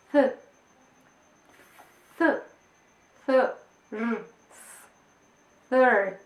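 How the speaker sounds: "th- th- thir- third" built up slowly from the th sound. Shown as a demonstration. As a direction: break "third" into its sounds for a child. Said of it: "hasta que les digo". ("th- th- thir- third" sonido por sonido, despacio)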